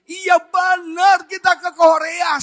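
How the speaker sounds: loud, high, drawn-out insistence; excited insisting. Retold or imitated. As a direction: re-enact a thrilled husband insisting. Husband insists it is true. (meyakinkan dengan girang, keras dan tinggi)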